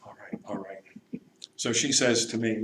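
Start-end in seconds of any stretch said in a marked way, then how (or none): 0.0-0.8 whispered resigned surrender; whispered giving in. Retold or imitated. Sister gives in wearily.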